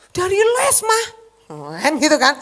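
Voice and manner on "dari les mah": put-on much higher, innocent teen; son's high voice. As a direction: play the son lying sweetly. (suara anak yang lebih tinggi)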